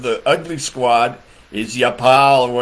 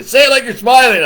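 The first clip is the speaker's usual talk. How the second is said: high, loud teacher imitation; mimicking the teacher; 9 semitones higher, 7 dB louder, faster than usual. Mandarin teacher correcting her student, high and loud. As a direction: pitch up and push it out as the bossy professor. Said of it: "She always said".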